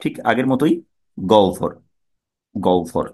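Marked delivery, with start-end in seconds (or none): 1.2-3.1 গহ্বর said as gou-vor; two-part gou-vor, no h; correct pronunciation. Shown as a demonstration. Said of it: "এটার উচ্চারণ কি হবে".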